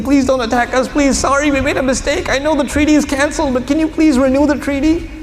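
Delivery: a leader reduced to frantic begging; begging and pleading. Acted. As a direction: beg desperately, pitch rising with each please. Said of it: "He's begging and pleading".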